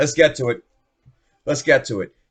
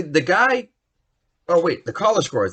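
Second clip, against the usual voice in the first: ordinary talk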